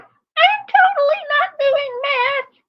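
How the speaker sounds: the lie pushes the voice up to a shriek. Retold or imitated. Streamer mocks the mother lying, shrill and loud. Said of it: "the harder she lies, the higher pitch".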